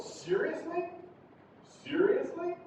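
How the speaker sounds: "seriously?" high and climbing; rising intonation. Shown as a demonstration. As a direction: lift "seriously?" upward in surprise. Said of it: "Again, that's going up".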